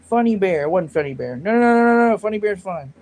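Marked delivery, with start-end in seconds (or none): 1.4-2.9 rapid, louder string of no's; hasty denial. Real, not acted. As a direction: cut off the mistake fast. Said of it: "quickly correcting himself".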